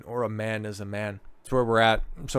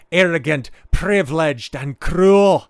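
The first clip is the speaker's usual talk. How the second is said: mocking emphasis; 6 semitones higher, 8 dB louder, slower than usual. Reading insults mockingly.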